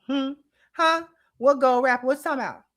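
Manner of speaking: high, feigned innocence; mock innocent denial. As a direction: play dumb about the wrappers.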